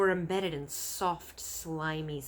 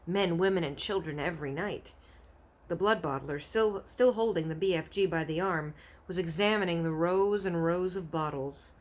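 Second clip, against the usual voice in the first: plain reading voice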